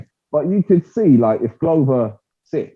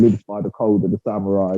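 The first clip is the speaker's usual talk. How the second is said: usual speaking voice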